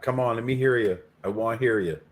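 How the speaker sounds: usual speaking voice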